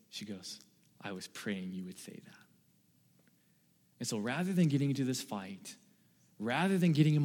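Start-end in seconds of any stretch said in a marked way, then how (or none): 1.0-2.4 softly imitating wife's gentle reply; a hint of her calm voice, hushed; mild imitation of wife. Pastor imitating his wife's gentle reply to his apology. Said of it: "she goes like this".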